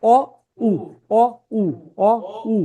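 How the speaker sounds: rounded অ alternating with short উ, air pushed out on উ; vowel contrast. Shown as a demonstration. Teacher contrasting air going in and out, vowel contrast. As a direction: switch from inward অ to outward উ. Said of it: "এটার ক্ষেত্রে বাইরে আসবে".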